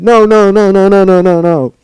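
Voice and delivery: loud high repeated screams; screaming no. Acted. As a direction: scream desperately. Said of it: "Dash screamed".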